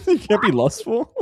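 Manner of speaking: high, laughing mock church scold.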